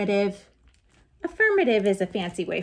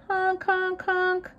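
car horn; 10 semitones higher, slower than usual. Acted. Cars honking at robot.

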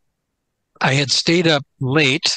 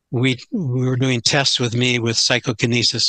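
normal talking voice

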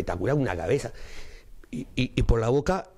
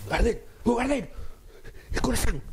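high frantic pleading; mock desperate high cry; panicked clown; 8 semitones higher than usual. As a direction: beg in a high wail. Pinocho panicking.

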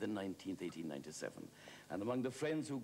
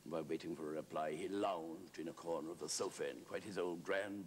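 normal talking voice

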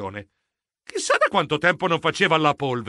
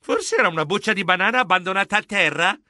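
ordinary delivery, nothing marked

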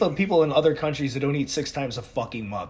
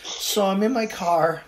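higher, slow, drawn-out phone confession; helpless caller; 5 semitones higher, slower than usual. Retold or imitated. Friend phones from the car not knowing what to do, helpless. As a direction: draw it out, imitating a stuck friend.

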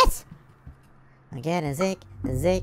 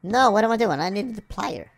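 normal talking voice

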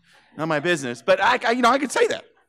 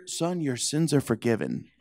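softer, slow and tender; gentle Jesus; 5 semitones lower, 7 dB quieter, slower than usual. Retold or imitated. Jesus speaking gently to the paralyzed man, soft and slow. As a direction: say it kindly, slowly.

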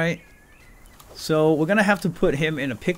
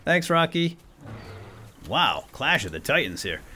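normal talking voice

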